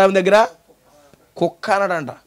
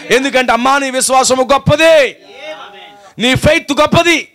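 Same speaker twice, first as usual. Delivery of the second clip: building preaching; 4 semitones higher than usual. Acted. Preacher builds Jesus' declaration.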